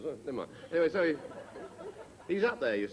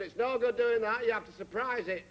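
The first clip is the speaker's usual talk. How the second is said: a knowing landlady calling up; brisk nosy landlady; 11 semitones higher, 3 dB louder than usual. Retold or imitated. Landlady gives her advice.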